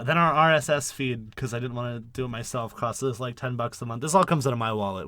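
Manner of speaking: usual speaking voice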